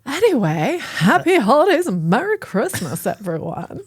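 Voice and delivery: Bob Gray voice. Acted. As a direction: put on a creepy clown voice. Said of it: "he turned into Bob Gray there".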